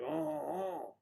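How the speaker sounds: drawn-out rising "oh, oh, oh"; mock Mexican whoop. Joker crossing the line into Mexico, mock whoop. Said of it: "cross the line into Mexico".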